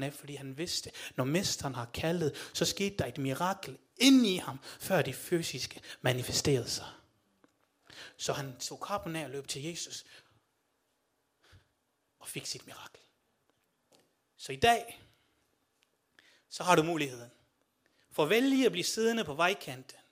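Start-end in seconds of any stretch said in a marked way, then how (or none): 2.5-10.0 loud, rousing sermon exhortation; rally the congregation with loud conviction; full-force preaching. Real, not acted. Preacher climaxes appeal. 12.2-13.0 whisper. Real, not acted. Quiet aside in climax. 14.4-20.0 loud, rousing sermon exhortation; rally the congregation with loud conviction; full-force preaching. Real, not acted. Preacher climaxes appeal.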